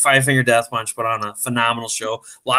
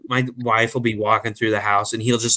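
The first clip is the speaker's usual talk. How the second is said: usual speaking voice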